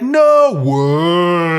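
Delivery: disgusted students. Retold or imitated. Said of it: "they're like".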